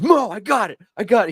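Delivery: high, thrilled rush of a discovery; imitating an overexcited buddy; excited friend. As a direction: burst in breathless with big news. Friend bursts in with papers.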